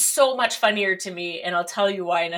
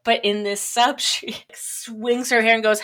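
ordinary delivery

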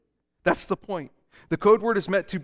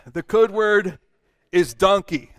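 loud reveal; 6 dB louder than usual. Acted. Pastor unveils the code word, louder.

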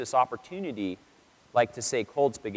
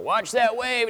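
movie surf coach call; 10 semitones higher, slower than usual. Retold or imitated. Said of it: "he's all".

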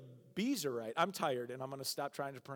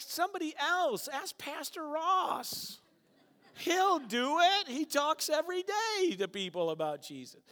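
much higher, slow whiny excuse; reluctant believer; 10 semitones higher, 6 dB louder, slower than usual. Retold or imitated. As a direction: beg God to pick someone else. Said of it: "and they go".

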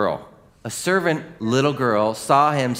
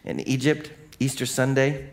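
ordinary talk, nothing marked

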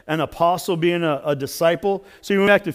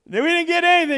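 high, loud holler in reply; shouting back; 10 semitones higher, 5 dB louder, faster than usual. Retold or imitated. Fishermen answering a man on the shore, shouting back. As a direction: call back across the water.

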